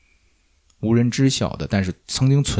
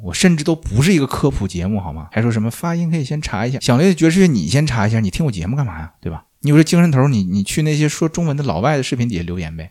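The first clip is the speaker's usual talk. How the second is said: exasperated ranting; 2 semitones higher than usual. Real, not acted. Podcaster firing back at pronunciation critics, exasperated rant. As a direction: tell the nitpickers off, pushing each jab. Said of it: "我要吐槽了".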